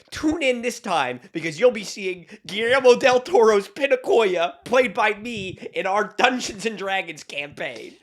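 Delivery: slow, loud movie-trailer voice; trailer announcer. Acted.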